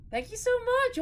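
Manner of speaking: squealed high thanks; excited. Real, not acted. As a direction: squeal it out, thrilled. Thanking gift subs.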